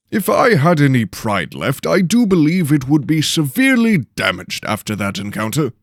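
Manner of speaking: offended lord scoffing at the slammed door. Acted. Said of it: "Lord Henley scoffs at the door".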